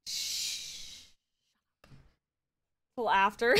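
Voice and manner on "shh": long, soft "shh"; hushing. Real, not acted. Streamer hushing the teasing chat, shushing. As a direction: shush them with a drawn-out hiss.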